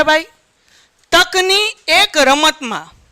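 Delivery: ordinary delivery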